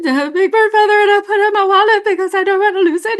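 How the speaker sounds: high, fast flustered rush; over-excited fan babbling too fast; nervous babble. Retold or imitated. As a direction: blurt it all out in one starstruck breath. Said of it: "I had no chill".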